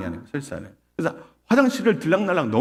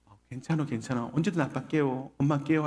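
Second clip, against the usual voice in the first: hushed retold comforting; consoling mother; 7 dB quieter than usual. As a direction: quietly soothe a child as mom would. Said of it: "그렇게 위로해 줬어요".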